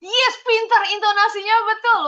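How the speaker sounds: high, loud and quick praise; excited praise. Real, not acted. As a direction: cheer the pupil's right tune. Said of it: "praising pupil".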